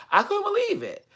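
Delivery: shrieking can't-believe-it; high disbelief. Real, not acted. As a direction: squeal it in shock. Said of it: "repeated disbelief".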